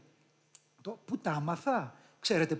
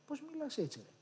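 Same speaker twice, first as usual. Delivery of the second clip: higher, much softer teasing question; φίλη που απορεί; 8 semitones higher, 11 dB quieter, faster than usual. Acted. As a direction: ask it like an amused, puzzled friend. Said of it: "που θα λέει στην αρχή". (απορημένα, χαμηλόφωνα)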